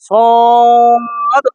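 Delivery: সদ with a long held vowel; Arabic letter sound. Shown as a demonstration. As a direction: Quran teacher demo. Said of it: "সদ, দত, ত এরকম না".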